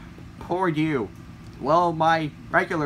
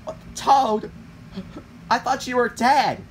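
shocked disbelief; 6 semitones higher than usual. Acted. Shock at seeing Toad alive.

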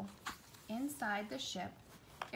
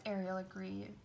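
ordinary delivery, nothing marked

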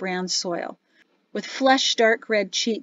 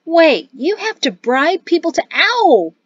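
high, loud question breaking into a yelp; indignant child, pitched up; astonished question; 8 semitones higher, 6 dB louder than usual. A narrator's reading. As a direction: blurt it in disbelief, cut off by pain. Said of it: "Peyto's question was abruptly stopped".

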